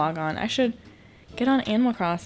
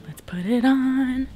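hushed whisper; breathy whisper to the camera; 3 semitones higher, 4 dB louder than usual. Real, not acted. Whispered while putting on lotion.

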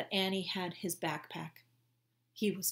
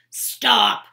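loud command; 4 semitones higher, 17 dB louder than usual. Acted. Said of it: "said the one with the very long mustache".

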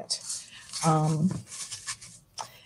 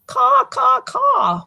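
much higher, cawing like a crow; much slower than usual. Acted. Librarian voicing the crow for kids, high cawing. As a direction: squawk it out like a crow. Crow's call in read-aloud.

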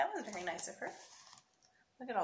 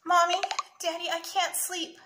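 high little-boy call, fully voiced; raised-pitch child's complaint; mock child whisper; 9 semitones higher, 9 dB louder than usual. A narrator's reading. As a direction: sleepless kid calling his parents. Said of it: "Sammy whispered".

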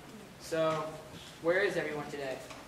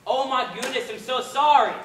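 shouted, flustered apology, much higher; loud apology; 7 semitones higher, 11 dB louder than usual. Acted. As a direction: blurt out a loud, panicked sorry. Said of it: "Please do not shout".

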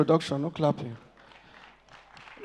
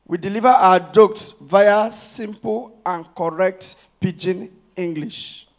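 slow, deliberate punchline; 3 semitones lower, slower than usual. Acted. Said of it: "correct English".

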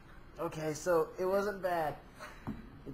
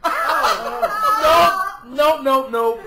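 loud laughing nope; shouting no; 8 semitones higher, 13 dB louder, much slower than usual. Real, not acted. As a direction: yell nope through a laugh. A contestant gives up.

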